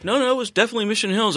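high, fast put-on caller; prank caller voice. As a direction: fake customer on the phone.

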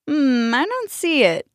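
slow, doubtful, lightly voiced; puzzled farmhand. A narrator's reading. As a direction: squint and doubt it slowly. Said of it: "he said as he squinted his eyes".

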